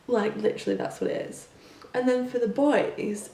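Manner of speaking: ordinary talk, nothing marked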